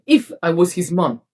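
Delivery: normal talking voice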